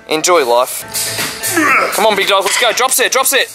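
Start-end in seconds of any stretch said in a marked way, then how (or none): 1.9-3.4 counting workout reps. Real, not acted. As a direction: push him through every rep. Spotting partner through a set.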